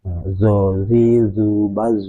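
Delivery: slow teacher demo; slow emphatic demo. Shown as a demonstration. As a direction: drag out zozi, zubaz. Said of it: "lidahnya kan naik ke langit atas".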